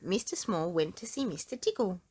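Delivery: ordinary delivery, nothing marked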